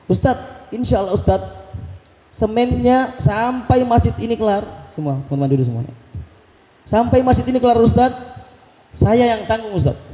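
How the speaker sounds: suara Pak Haji yang tinggi dan bersemangat; menirukan Pak Haji. Retold or imitated. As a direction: tirukan dermawan yang berjanji dengan mantap. (Pak Haji berjanji menanggung semen, suara tinggi bersemangat)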